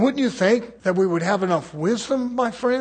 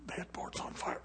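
whispered tattle; 21 dB quieter than usual. Retold or imitated. Child whispering the report.

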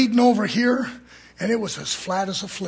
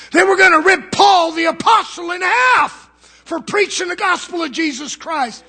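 higher, louder shouted preaching; preaching at full force; 8 semitones higher, 7 dB louder than usual. Real, not acted. Preacher shouting to the congregation about Paul's suffering. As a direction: preach it with fire.

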